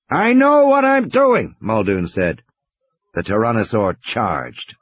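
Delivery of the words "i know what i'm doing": high, slow, drawn-out insistence; insistent retort. A narrator's reading. Muldoon answers the radio warning as he stands his ground, insistent. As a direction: push back against the order, stretching it out high.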